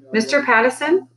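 high, formal summons; announcing. A narrator's reading. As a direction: announce it officiously. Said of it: "Lionel Tailwugger announced".

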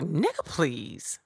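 drawn-out dismissive retort, lightly put on; slow, sassy brush-off in imitation; Miss Sophia. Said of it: "in my best Miss Sophia voice ever".

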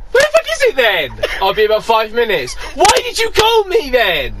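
mock indignant yell. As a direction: snap back like a hungry relative. Family retorts, acted quarrel.

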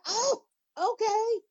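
very high, slow drawn-out okay; exaggerated high-pitched feigned acceptance; mock-surprised okay. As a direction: stretch it high, taking up the challenge. Retold sarcastic reaction before punching.

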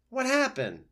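high, loud breathless fox; panting fox. Acted. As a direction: gasp it out like a fox that ran. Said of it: "the fox panted".

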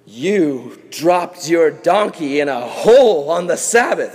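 slow, put-on judgmental voice; hammed-up pompous rule enforcer; accusing Pharisee. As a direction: draw out the accusation with mock gravity. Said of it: "That's what the Pharisees would do".

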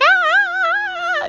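short high-pitched mock scream. Retelling the kids' screams in the movie, high scream. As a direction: let out one quick shrill scream. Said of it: "four screams".

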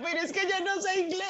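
high, pleading voice of someone lost at customs; flustered helpless traveler. Retold or imitated. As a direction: squeak it out like you're totally lost.

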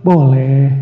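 low, long-held "Boleh"; reluctant-sounding low yes; low drawn-out intonation. Shown as a demonstration. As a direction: say "Boleh" slowly on a deep, level tone.

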